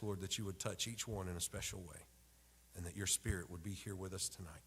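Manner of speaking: ordinary talk, nothing marked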